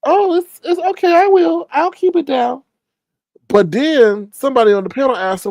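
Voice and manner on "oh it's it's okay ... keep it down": lighter, higher mock-polite voice; high sweet Tracy voice. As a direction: play her sugary and innocent. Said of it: "And she says".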